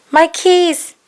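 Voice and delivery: high, loud cry of dismay. A narrator's reading. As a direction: suddenly realize the keys are inside. Mom locked out of house.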